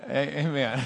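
usual speaking voice

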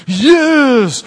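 loud, high burst of joy; triumphant yes. Acted. Gideon to his servant, loud and triumphant. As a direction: punch it like a fist pump. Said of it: "Gideon looks at his servant and goes".

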